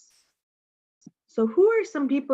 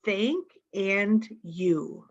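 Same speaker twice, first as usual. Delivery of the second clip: "thank and you" said very slowly, word by word; slow deliberate "thank and you"; slow and spelled out; much slower than usual. Shown as a demonstration. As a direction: lay out "thank" then "you" like teaching a child. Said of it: "these two magic words are thank".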